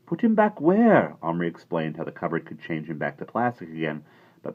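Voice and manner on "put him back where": high, loud, slow outrage; indignant boy. A narrator's reading. Patrick refusing to give the cowboy back. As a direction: object hotly.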